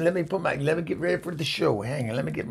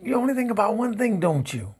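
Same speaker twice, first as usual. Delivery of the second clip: high, quick accusing statement; exasperated accusation; 8 semitones higher than usual. Acted. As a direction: throw it back fast as an accusation. Same words turned into an accusation.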